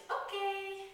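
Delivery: high, bright "Okay"; chipper okay. Shown as a demonstration. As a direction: lift "Okay" up cheerfully.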